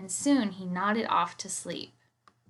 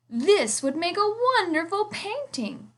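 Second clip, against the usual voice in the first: inspired artist; 9 semitones higher than usual. Acted.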